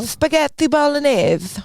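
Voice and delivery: "Spaghetti bolognese" high and slow, Italian with th for s; lisped Italian accent. Shown as a demonstration. Co-host testing whether an Italian accent hides lisp, lisped. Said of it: "if you're to have a lisp".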